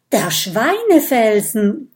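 loud joyful discovery; excited call. Acted. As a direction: shout it out, you found it. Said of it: "ruft Kokosnuss".

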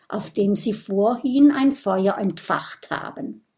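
ordinary delivery

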